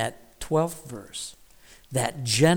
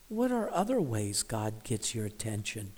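ordinary talk, nothing marked

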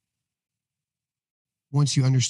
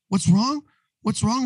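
higher, exasperated mock outburst; retold explosion; 9 semitones higher, slower than usual. Husband snapping back at his worried wife, mock outburst. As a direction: throw her question back at her, pitched up. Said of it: "I just explode".

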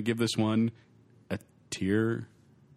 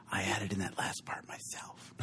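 whispered aside; 6 semitones lower, 11 dB quieter than usual. Real, not acted. Aside after the quote.

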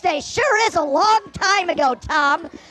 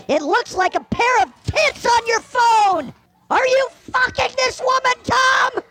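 angry accusation; 6 semitones higher than usual. Acted. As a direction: confront him, voice rising. Wife finds photos, accuses.

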